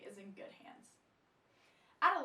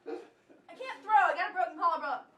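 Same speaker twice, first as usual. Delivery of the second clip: laughing; 6 semitones higher, 12 dB louder than usual. Real, not acted. Anchor joking about her throw, laughing. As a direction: laugh through the excuse.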